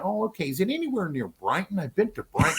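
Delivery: breezy curious American; touch of a high drawling American voice; imitating Owen Wilson. Still quoting Owen Wilson.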